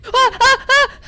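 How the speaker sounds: loud high-pitched terrified shrieks; high panicked scream. Real, not acted. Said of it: "I apologize for screaming".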